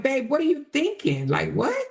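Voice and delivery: high, stunned voice asking what he was thinking; incredulous high mock. Mock-talking to the man in disbelief, high and incredulous. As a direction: pitch up in disbelief at him. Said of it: "I would've been like".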